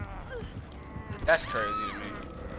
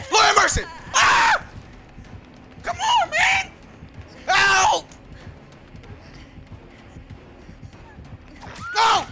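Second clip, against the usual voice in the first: high, loud panicked screams; yelling in panic; 12 semitones higher, 4 dB louder, slower than usual. Real, not acted. Gamer caught by the killer, yelling in panic. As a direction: shriek as the killer catches you. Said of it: "I'm sorry for yelling".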